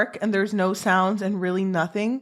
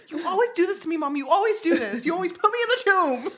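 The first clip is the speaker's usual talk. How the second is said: whiny son; 9 semitones higher, much faster than usual. Retold or imitated. Son whining at his mother.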